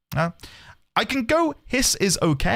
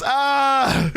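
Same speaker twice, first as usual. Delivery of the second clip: mock scream; 6 semitones higher than usual. Proposing the scream name.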